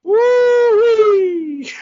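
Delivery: high, loud woo-wee; excited whoop. Real, not acted. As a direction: holler it gleefully. Whooping after the marijuana joke.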